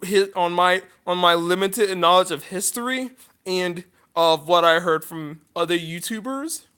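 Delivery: ordinary talk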